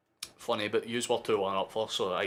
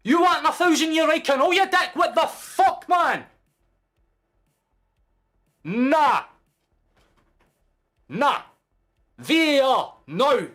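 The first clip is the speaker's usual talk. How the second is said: screaming in outrage at the ref; enraged football fan yelling; furious shout; 10 dB louder than usual. Real, not acted. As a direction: bellow it at the top of your lungs, high and loud. Raging at referee.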